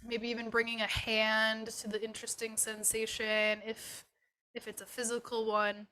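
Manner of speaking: unhurried and settling, guide them gently inward; guided meditation. Acted. Yoga teacher leading students through a body scan, guided meditation.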